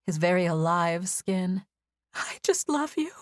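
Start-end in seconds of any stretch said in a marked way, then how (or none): 2.1-3.1 high and breathless through tears; breathless, tearful. A narrator's reading. Evie tells the wounded Ozzy she loves him, high and breathless. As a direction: blurt it out while crying. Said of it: "I said breathlessly".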